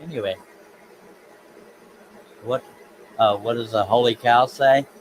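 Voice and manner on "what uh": usual speaking voice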